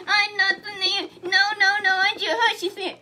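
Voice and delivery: high, loud panicked scream; screaming and crying. Acted. Jehoshaphat screaming at the enemy soldiers, high panic. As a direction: shriek it in terror. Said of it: "he started screaming and crying".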